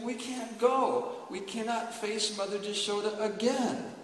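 loud, high, anguished lament; grieving Baladev. Acted. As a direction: cry it out as the heartbroken big brother. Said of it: "and Uddhava was crying".

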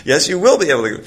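insist, as if retelling a friendly argument; arguing insistence. Retold or imitated. Devotee reassures the guard about karma, insistent. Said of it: "He has an argument with him".

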